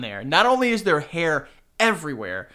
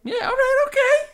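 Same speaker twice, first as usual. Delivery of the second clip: mock accent; 4 dB louder than usual.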